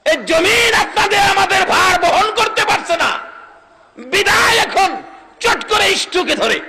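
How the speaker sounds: high, shouted sermon denunciation; full-force preaching. Real, not acted. Preacher blaming strokes on cheating, shouting at the congregation. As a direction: hurl each phrase at the crowd, very high and loud.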